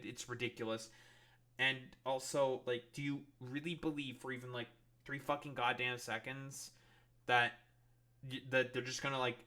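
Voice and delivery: ordinary talk, nothing marked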